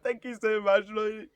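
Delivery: high, tearful mock thanks; imitated crying. Thanking the panel while crying at the audition, imitated sobbing. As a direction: squeak out thanks through put-on sobs. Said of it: "I'm there crying in the middle".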